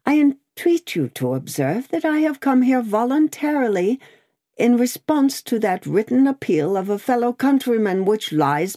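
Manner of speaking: high-pitched, insistent prisoner voice; earnest protesting prisoner. Acted. Prisoner entreats the officer for his rights, earnest protest.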